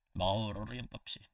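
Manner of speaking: ordinary talk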